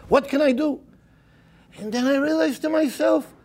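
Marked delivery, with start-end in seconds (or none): none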